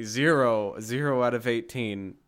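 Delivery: imitating his blunt dismissive verdict; mock critic verdict. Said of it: "you're just gonna say".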